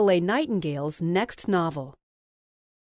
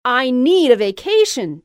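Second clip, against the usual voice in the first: loud high shout; excited shout; 5 semitones higher, 9 dB louder than usual. A narrator's reading. Louise shouting to Jessica, loud and high. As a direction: holler it out. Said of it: "she shouted".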